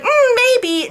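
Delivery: high, coy mock hesitation; coy mock Anna. Anna weighing the contract, high coy mock. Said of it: "and she's like".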